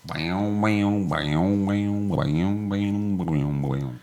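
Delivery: vocal mouth harp imitation; boing the syllables like a jaw harp; juice harp twang. Playing imaginary juice harp.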